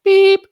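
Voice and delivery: a sharp high loud beep; imitated beep. Mimicking a voicemail tone between his messages, a high loud beep.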